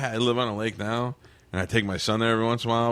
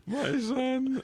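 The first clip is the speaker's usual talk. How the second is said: exasperated dad; 3 dB quieter than usual. Real, not acted. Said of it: "exasperated father".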